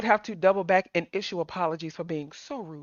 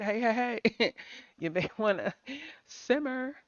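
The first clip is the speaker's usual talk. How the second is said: soft calming warning; 8 dB quieter than usual. Retold or imitated. Voicing Charlie calming her down.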